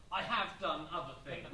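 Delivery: low, wounded-pride retort; mock-haughty, put out; pissed off, haughty. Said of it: "he looked all pissed off and haughty".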